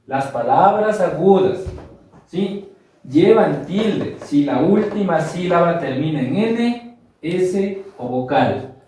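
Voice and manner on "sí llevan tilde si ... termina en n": ordinary delivery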